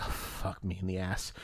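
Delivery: very low, hushed exasperated mutter; muttered aside. Retold or imitated. Clerk despairing to himself over a confusing customer, muttered low. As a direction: grumble it privately under your breath.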